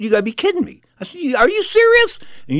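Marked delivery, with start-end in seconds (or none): 0.0-0.8 higher, incredulous 'you gotta be kidding me'; mock-stunned retort to the girlfriend; retold disbelief. 1.2-2.2 much higher, louder, stunned question; astonished yelp at her coffee choice; retold disbelief.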